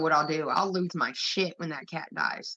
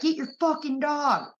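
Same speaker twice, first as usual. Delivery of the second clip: higher, sharp mock yell from dad; dad's angry voice; 7 semitones higher than usual. Dad yelling at his kid about the dog, mock angry shout. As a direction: bark it like an annoyed dad. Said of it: "got his big, mean, angry voice".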